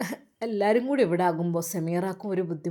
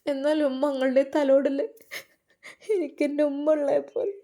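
put-on higher, tearful young woman's voice; near tears; 5 semitones higher than usual. Sameera to her mother about lost parents, tearful and higher. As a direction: voice lifting as she starts to cry. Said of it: "മോളെ കരയല്ലേ".

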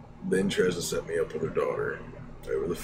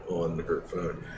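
normal talking voice

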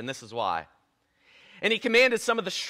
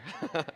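1 soft laugh; 2 semitones lower, 9 dB quieter than usual. Real, not acted. Laughs at own joke.

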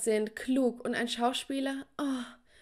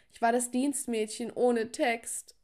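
ordinary delivery

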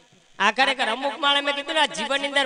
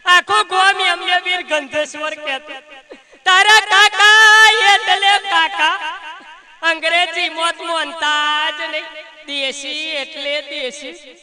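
mock wailing lament; 3 semitones higher, 8 dB louder, slower than usual. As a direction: wail it out like a hammed-up mourner. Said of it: "રડતા રડતા કહેતા હતા".

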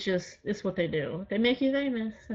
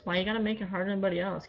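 ordinary delivery

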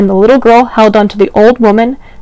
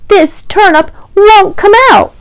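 mock exasperation; 10 semitones higher than usual. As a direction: play up the frustration for kids. Old man frustrated by stuck turnip.

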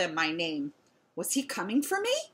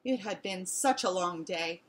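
ordinary delivery, nothing marked

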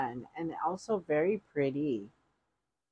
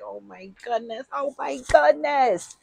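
high, excited reenactment that jumps louder; overjoyed reaction; 8 semitones higher, 5 dB louder than usual. Retold or imitated. Retelling her reaction to surprise gift.

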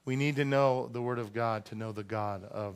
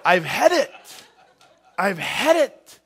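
high, loud, slow exasperated cry; fed-up mom yell; 6 semitones higher, 9 dB louder, slower than usual. Retold or imitated. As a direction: sound completely fed up. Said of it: "your mom or your grandma say".

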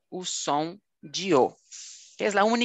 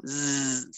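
soft buzzing zzz; long buzzing zzz sound; 5 semitones lower, 5 dB quieter than usual. Shown as a demonstration. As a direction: buzz the z against the teeth. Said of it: "como um zumbido".

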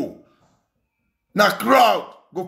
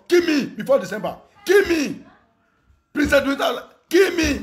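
defiant shout; 7 semitones higher than usual. Real, not acted. Daring the pastors to strike him down, shouting. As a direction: fling the challenge at them.

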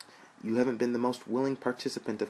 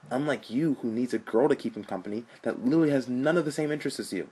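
defiant rant; 5 semitones higher, 4 dB louder than usual. Acted.

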